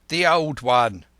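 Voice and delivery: mild shout from the crew; calling out. Acted. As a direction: call it across the deck. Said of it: "cried another".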